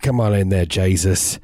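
put-on Australian outback accent; broad Australian accent, ordinary pitch; Crocodile Dundee. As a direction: order someone about in an Aussie twang. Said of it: "Crocodile Dundee comes in".

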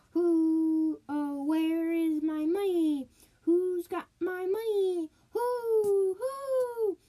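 high, loud, sobbing ghostly wail rising to a cry. A narrator's reading. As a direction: wail like a dead woman who cannot find her money. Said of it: "the ghost of the dead woman cried".

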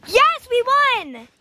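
high, loud shout of triumph; elated, high-pitched yell; victory cheer. Real, not acted. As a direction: cheer the win at full voice. Team wins the challenge.

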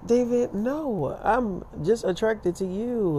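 imitated drawn-out plea; mock pleading denial. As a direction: draw it out slow and high, imitating a lover's denial. Acting the other person's pleading denial.

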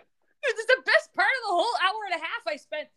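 can't contain the excitement; excited. Real, not acted. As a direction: rave about it in a rush. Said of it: "gushing about a commercial".

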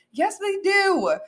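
high, loud, drawn-out contradiction; emphatic rebuttal. Real, not acted. Podcaster contradicting [name]'s song, emphatic rebuttal. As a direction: insist on it, stretching it out high.

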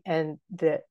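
ordinary delivery

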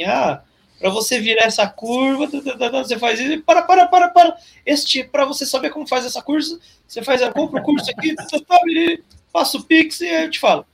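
high, pushy sales-pitch voice; hammed-up greedy course seller; mock course seller. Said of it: "um cara que vai falar assim". (vendedor de curso caricato e agudo)

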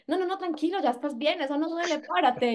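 put-on high, brisk brush-off to a child; mock mom telling her child to get up; parent soothing a fallen child. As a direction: shoo the kid up with a lightly put-on parent voice. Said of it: "que uno le dice".

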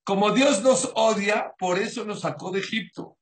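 mock complaint. Mocking the ungrateful people's complaint.

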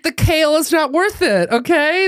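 high, pleading mock panic; panicked pleading. Begging grandma in the car to skip the grocery store, high mock panic. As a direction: beg her to skip the groceries. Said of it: "I was like".

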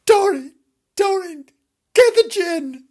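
loud call across the house. Real, not acted. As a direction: shout for her urgently. Calling to Doreen.

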